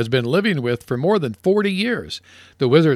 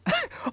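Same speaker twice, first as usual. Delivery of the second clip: laughing; 12 dB quieter than usual. Real, not acted. Laughs at punchline.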